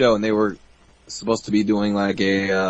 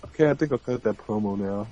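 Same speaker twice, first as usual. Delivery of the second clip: casual Bret Hart; 3 semitones lower, 8 dB quieter than usual. Retold or imitated. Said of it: "Bret Hart's like".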